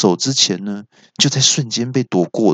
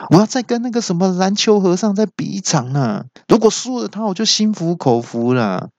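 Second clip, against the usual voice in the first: high whiny monster voice; sulky monster; 9 semitones higher than usual. Acted. The big monster rubbing his head, high whiny sulking. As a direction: whine like a sore loser. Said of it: "大怪一边用手揉着头，一边说".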